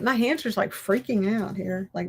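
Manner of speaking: ordinary delivery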